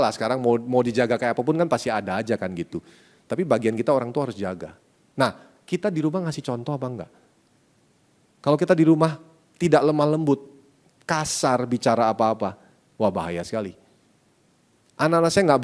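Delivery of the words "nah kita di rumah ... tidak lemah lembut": ordinary delivery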